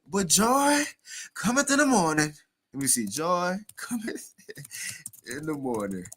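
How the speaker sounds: teasing put-on church accent; mock Kamala Harris accent. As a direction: mimic her new preacher accent. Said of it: "In her new accent".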